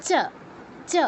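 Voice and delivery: চ as short 'chuh', tongue on the palate; isolated palatal চ; Sanskrit cha. Shown as a demonstration. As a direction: demonstrate চ with unrounded short vowel. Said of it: "কিভাবে উচ্চারণ করব".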